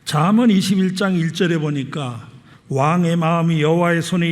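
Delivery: loud pulpit declamation; forceful preaching. Real, not acted. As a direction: proclaim it firmly to the congregation. Scripture reading and exhortation.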